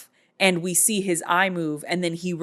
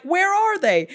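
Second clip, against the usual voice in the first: high-pitched comic outcry; mock frantic demand; 13 semitones higher, 4 dB louder than usual. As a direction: imitate a king demanding answers. Retold king demanding missing pair.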